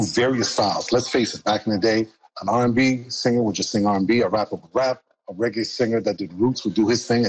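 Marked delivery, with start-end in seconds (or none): none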